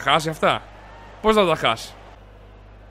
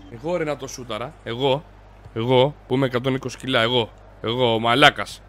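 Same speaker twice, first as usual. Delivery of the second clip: much lower, softer voice; low grumble; 7 semitones lower, 7 dB quieter than usual. Real, not acted. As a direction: grumble to yourself in disbelief. Grumbling after a missed chance. (χαμηλόφωνη γκρίνια)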